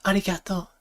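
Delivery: high, light anime-girl imitation; girly anime voice. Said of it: "like, a girly voice".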